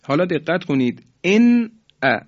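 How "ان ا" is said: "ان ا" with a short, clear noon before the hamza; izhar noon. Shown as a demonstration. Teacher demonstrates noon before hamza, clear izhar. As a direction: say "ان ا" higher and louder, noon fully sounded.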